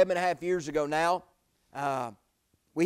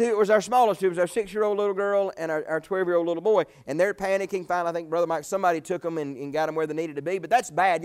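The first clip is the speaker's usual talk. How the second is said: ordinary delivery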